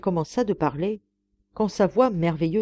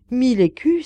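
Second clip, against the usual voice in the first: louder, higher, alarmed exclamation; startled cry; 6 semitones higher, 6 dB louder than usual. A narrator's reading. Old woman shocked by Schröder's offer, crying out. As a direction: cry out, frightened by the sum. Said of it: "s'écria-t-elle".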